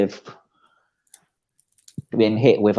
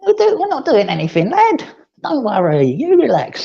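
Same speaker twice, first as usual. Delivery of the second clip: higher, sing-song voice soothing a cat; pet talk to cat. Pretend. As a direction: coo at the cat to settle him.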